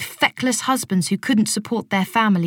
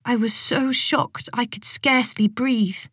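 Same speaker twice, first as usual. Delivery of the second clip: usual speaking voice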